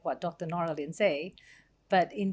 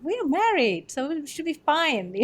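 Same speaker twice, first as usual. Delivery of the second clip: self-reassuring quote; 9 semitones higher, 4 dB louder than usual. Retold or imitated. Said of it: "you kind of have to, like".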